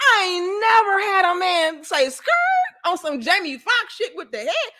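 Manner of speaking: high, laughing, half-crying disbelief; high-pitched shocked laughing protest; stunned disbelief. Retold or imitated. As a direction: squeal it out in shocked amusement. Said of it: "I said".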